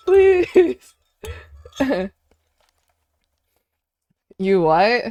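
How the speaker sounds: ordinary talk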